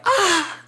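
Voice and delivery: a sudden high-pitched yelp, imitated; mock high yelp. Mimicking the knocked-out dude's cry with a high yelp. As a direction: squeal it like a man knocked out cold. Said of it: "all he heard was".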